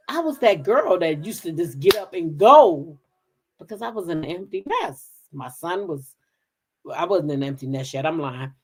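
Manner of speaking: ordinary delivery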